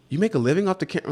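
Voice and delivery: high, fast disbelieving question; incredulous friend. Retold or imitated. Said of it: "And they're like".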